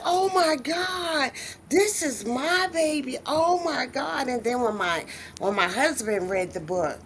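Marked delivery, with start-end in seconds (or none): 0.0-4.3 high, drawn-out amazement; retold awe. Author first holding her book, high awed wonder. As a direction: marvel in a high voice, savoring it.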